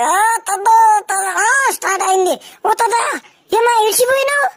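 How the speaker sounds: excited shout after him; calling out. Real, not acted. Calling out to grandpa.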